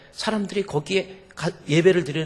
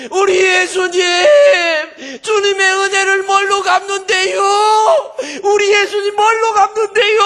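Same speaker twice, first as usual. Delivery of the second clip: very high screamed sobbing plea; acted-out anguished bawling; wailing tearful prayer; 12 dB louder than usual. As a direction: wail to Jesus at full volume, imitating. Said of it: "그러면서 울면서".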